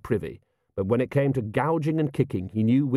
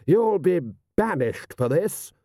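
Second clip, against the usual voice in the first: high, slow outraged threat; 7 semitones higher, slower than usual. A narrator's reading. Lord threatening the patrician with banishment, outraged. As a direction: hammer it out in indignation.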